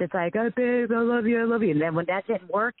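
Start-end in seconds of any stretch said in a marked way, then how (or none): 0.4-1.7 high-pitched imitation of a pleading partner; mock lovey-dovey begging. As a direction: plead in a raised voice, imitating him. Imitating abuser pleading after she left.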